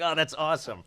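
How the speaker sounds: laughing through the words; real amusement spilling over; laughing as he quotes his own reaction. Real, not acted. Laughs while quoting himself.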